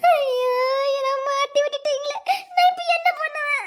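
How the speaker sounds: high, tearful wail of despair; wailing lament. Acted. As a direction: wail as if badly trapped. She laments being trapped. (ஐயோ என்று புலம்பி அழுவது)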